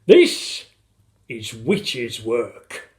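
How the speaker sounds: dramatic, slow proclamation; crying out. A narrator's reading. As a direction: cry out in drawn-out horror. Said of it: "cried Mister Gregg".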